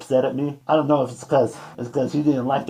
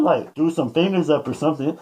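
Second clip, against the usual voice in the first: normal talking voice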